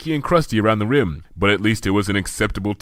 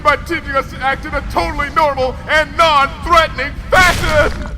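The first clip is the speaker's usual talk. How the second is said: higher, formal, swelling to a loud boom; grand royal Luna rising to a shout; 4 dB louder than usual. A narrator's reading. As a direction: proclaim gratitude then thunder it. Luna thanks Twilight grandly.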